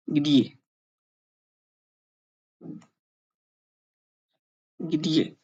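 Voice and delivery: गिद्ये said as GID-ye; correct pronunciation. Shown as a demonstration. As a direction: say गिद्ये with an i in the first syllable. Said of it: "इसका pronounce होता है". (गिद्ये, सही उच्चारण)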